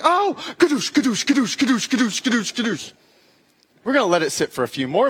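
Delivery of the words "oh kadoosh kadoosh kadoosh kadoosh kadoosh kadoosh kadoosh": high imitated foot pump whooshes; doctor mimicking an air pump; pump noise. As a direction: pump the air out with each kadoosh. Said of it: "He's like".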